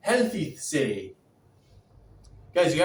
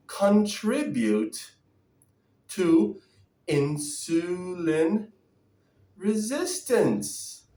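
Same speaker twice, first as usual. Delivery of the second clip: slow dictation; much slower than usual. Real, not acted. Slowly dictating question to ChatGPT.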